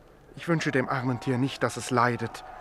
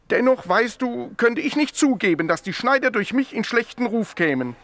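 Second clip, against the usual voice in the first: higher and louder, pressing the point; an agitated, raised protest; heated insistence; 10 semitones higher, 6 dB louder than usual. Acted. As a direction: push back hard, voice raised. Defends tailors' reputation heatedly.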